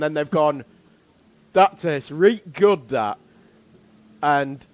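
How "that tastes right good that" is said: mock Yorkshire voice. Voicing caveman tasters, Yorkshire "reet.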